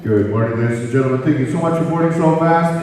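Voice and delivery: low, loud captain on the microphone; deep official PA greeting; pilot's cabin announcement. Acted. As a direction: announce it like a mock airline pilot. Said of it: "I got the microphone and I said".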